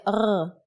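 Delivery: R rolled from the throat; a throaty French-like R; gargled R. Shown as a demonstration. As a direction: roll the R as if gargling water. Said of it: "as if you are gargling water".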